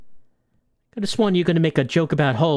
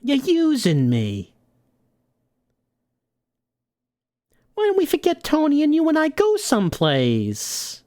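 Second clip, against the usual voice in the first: higher, coaxing dame voice; hurt moll; 11 semitones higher than usual. Acted. Moll coaxing her gangster to run away, high and sweet.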